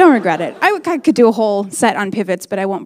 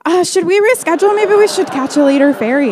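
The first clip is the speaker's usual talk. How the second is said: high apologetic; 7 semitones higher, 3 dB louder, faster than usual. Retold or imitated. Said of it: "I'd be like".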